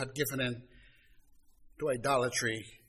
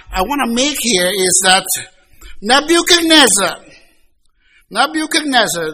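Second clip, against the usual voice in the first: loud, higher, driving sermon climax; fervent pulpit preaching; full-force preaching; 9 semitones higher, 11 dB louder than usual. Acted. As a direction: preach it out to the congregation.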